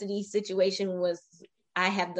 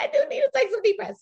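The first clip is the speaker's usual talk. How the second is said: flustered laugh; 3 dB louder, faster than usual. Retold or imitated. Flustered reply to son.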